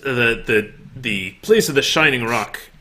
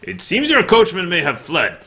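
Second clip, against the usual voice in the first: haughty noble voice; 8 semitones higher than usual. Acted. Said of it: "he kinda leans back and he goes".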